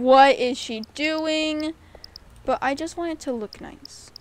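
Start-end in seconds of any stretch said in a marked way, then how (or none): 0.0-1.7 loud, slow mock disbelief; imitated baffled viewers. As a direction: mimic an appalled onlooker, stretching it out. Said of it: "watching this going".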